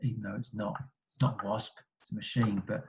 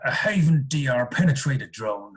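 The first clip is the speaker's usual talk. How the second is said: put-on American voice for a gadget name; playful American tech-spec voice; mock American accent. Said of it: "say that in an American voice".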